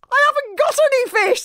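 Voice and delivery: speaking Dragonese, very high and strained; boy's squeaky dragon-speak; Hiccup. A narrator's reading. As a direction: answer in high-pitched dragon language. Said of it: "said Hiccup in Dragonese".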